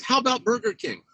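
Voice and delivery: a hint of a little boy asking; mock child request. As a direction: echo it like an eager kid. Brother repeats the line aloud.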